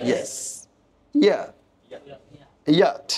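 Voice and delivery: "yes", ইয়া, ইয়াট each starting on a Y glide; Y sound demo. Shown as a demonstration. Teacher giving examples of Y, careful pronunciation demo. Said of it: "ওয়াইয়ের উচ্চারণ ইয় এর মতো হয়".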